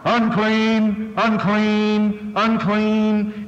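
high, drawn-out monotone leper's call; crying out. Acted. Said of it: "put his hand out from his mouth and cry".

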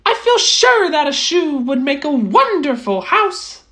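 high, pleased little mouse voice; a small mouse admiring a cozy hut. Acted. Mouse admiring the straw hut, high and content.

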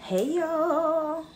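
usual speaking voice

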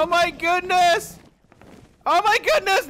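high, drawn-out cries of dismay; high-pitched horrified exclaiming; shocked alarm. Real, not acted. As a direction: wail in shock at a game disaster. Thrown in the water in game.

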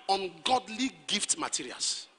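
ordinary talk, nothing marked

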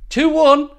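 high mock pundit voice, clipped answer; grumpy Roy answer.

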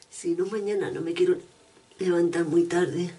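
usual speaking voice

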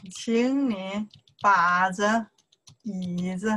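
very slow, stretched syllables; drawn-out language-teacher demonstration; slow model sentence. Shown as a demonstration. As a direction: model it word by word for beginners. Teacher models sentence again slowly.

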